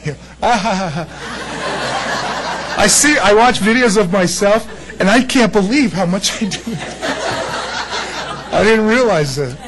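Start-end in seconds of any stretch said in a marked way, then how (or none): 0.4-2.8 slow laugh. Real, not acted. Laughs at his hand gestures. 6.7-8.5 breathy laugh. Real, not acted. Laughs at himself.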